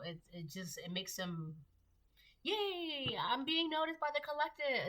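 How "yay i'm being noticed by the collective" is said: much higher, chirpy mocking voice; mock gleeful copycat. Reader mocking the copycat's glee, high and chirpy. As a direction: mimic a childish show-off. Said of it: "It's like that".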